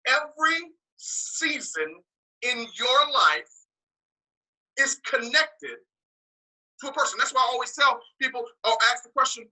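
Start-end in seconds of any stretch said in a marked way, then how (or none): 0.1-7.2 very slow, with gaps between phrases; slow repeat. Shown as a demonstration. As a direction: say it again slowly, phrase by phrase. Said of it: "Let me say it again slowly".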